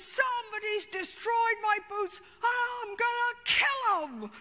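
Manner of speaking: high, loud threat, slowing down; raging threat. Retold or imitated. Bruce threatens the group over his boots, raging high shout. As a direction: threaten to kill whoever did it. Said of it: "He says".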